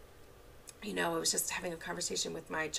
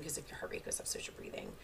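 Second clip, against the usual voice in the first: whispered aside; 9 dB quieter, faster than usual. Real, not acted. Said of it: "whispered explanation".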